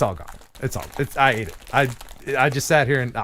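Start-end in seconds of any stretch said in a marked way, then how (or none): none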